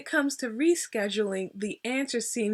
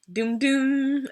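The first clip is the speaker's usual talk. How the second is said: louder, higher sung-out "doom, doom"; Law and Order sting; 4 semitones higher, 5 dB louder than usual. Acted. Vlogger teasing her story, mock dramatic sting. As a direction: voice a dramatic TV crime-show sting. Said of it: "one of those Law and Order shows".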